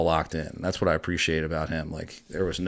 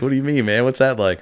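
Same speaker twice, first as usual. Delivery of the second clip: curious [name]; 7 semitones higher, 7 dB louder than usual. Retold or imitated. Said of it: "He's like".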